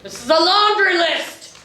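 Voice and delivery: high outraged cry at laundry; outraged exclaim. Acted. Captain reads memo, outraged.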